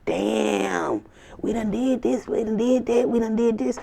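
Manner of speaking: high, whiny complaining voice; mock their failed efforts; hammed-up exasperated rivals; frustrated haters. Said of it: "These people are like".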